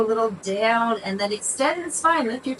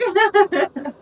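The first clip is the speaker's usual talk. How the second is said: laughing; 10 semitones higher, 5 dB louder than usual. Real, not acted. Laughing about the dog.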